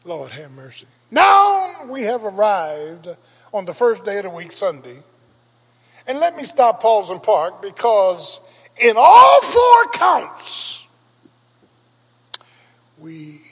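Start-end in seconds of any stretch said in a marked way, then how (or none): 1.1-10.9 high, loud whooping preacher's cry; preaching at full force. Acted. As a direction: build the congregation to a roaring peak. Preacher rises again.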